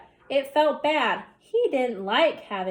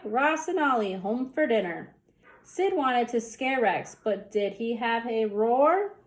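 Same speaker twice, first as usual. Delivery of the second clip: plain reading voice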